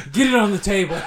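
loud, high order through laughter; mock surgeon command. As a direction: bark a pretend operating-room order. Plays cop as emergency surgeon.

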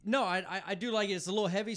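loud and high; way too loud. Real, not acted. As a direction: blurt it out too loud for the mic. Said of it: "You're way loud".

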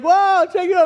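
high, loud amazed shout; hyped-up cheering voice; excited onlookers. Retold or imitated. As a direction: imitate a crowd hollering in excitement.

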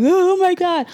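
high-pitched whiny wail, hammed-up; put-on crybaby whine; mock whining. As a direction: imitate someone whining and boohooing. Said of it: "and whining and like".

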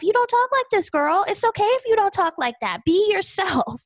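urgent, high appeal straight to Brandi; a friend begging her to just be herself, high and excited; high excited plea. Real, not acted. Pleading directly with Brandi.

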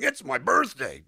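big, high, drawn-out shout; amplified stage delivery. Shown as a demonstration. Actor overplaying a line meant for film, booming and high. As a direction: play it far too big for film. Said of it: "we have to learn to amplify it".

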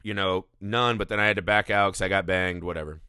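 lightly voiced nun admitting her sins; mock confession. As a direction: shrug it off in a low voice. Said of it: "She goes in and she's like".

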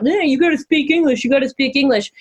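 mocking bigot. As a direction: mimic an obnoxious person making demands. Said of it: "when people are being dicks about".

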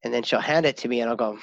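ordinary talk

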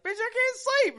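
high, loud frustrated protest; exasperated yell. Retold or imitated. Complaining to his wife who turned the TV up. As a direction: whine it loudly like you can't believe her.

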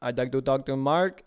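put-on Indian accent for a prank caller; mock Indian-accented phone greeting. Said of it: "I did my Indian accent".

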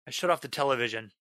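ordinary delivery

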